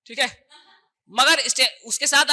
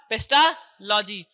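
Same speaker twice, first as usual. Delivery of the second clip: slow spelled-out name; 3 semitones lower, much slower than usual. Shown as a demonstration. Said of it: "paste, paste, ठीक है, कौन सा हो जाएगा?".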